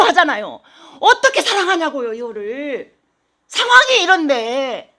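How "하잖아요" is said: ordinary delivery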